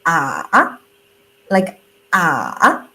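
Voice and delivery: low "Ah" dipping down then up; third tone dip. Shown as a demonstration. Teacher demonstrating the dipping third tone. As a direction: dip "Ah" low and bring it back up. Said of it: "third tone is like".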